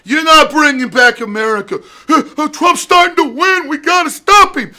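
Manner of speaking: high, loud frantic parody; mock Deutsch voice. Mocking the host desperate to stop Trump, high loud squeal. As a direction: squeal it like a panicking pundit. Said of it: "this guy going".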